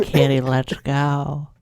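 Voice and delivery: ordinary talk, nothing marked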